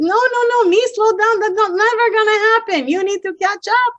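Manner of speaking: high, fast mocking retort. Speaker to naysayers, high fast mocking comeback. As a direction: toss it back at the doubters, high and quick.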